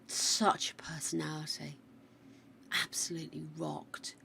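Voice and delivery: soft, fond whisper. Real, not acted. Whispering fondly about the late dog Stitch. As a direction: whisper it tenderly.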